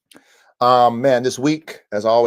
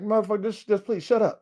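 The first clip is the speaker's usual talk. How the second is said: exasperated pleading; 11 semitones higher than usual. Retold or imitated. Owner to dogs barking at night, high exasperated pleading. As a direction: beg in a high mock-weary register.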